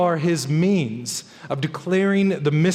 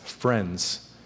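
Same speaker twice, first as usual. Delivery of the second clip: "friends" dropped much lower and much softer; soft echoed friends; 10 semitones lower, 7 dB quieter than usual. Retold or imitated. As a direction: let "friends" fall away softly, almost to yourself. Soft echo of punchline.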